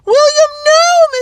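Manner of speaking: very high, drawn-out scream; wife screaming at her husband not to go. A narrator's reading. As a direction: scream to stop him. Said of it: "Mistress Hewson screamed".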